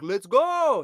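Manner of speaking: loud, high rallying yell; sudden big shout, drawn out; imitated terrorist shout. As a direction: mimic a movie villain yelling orders. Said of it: "The Libyan terrorists".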